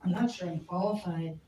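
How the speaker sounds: usual speaking voice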